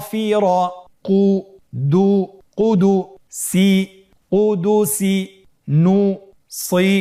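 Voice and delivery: syllables built up one by one, loud and high; syllable drill. Shown as a demonstration. Teacher leads students syllable by syllable, loud slow call-outs. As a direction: call each syllable out loud for the class to echo. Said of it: "আমার সাথে বানান করুন".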